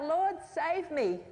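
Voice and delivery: mildly acted cry for help. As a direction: cry out for rescue, drawn out. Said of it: "and he cried out".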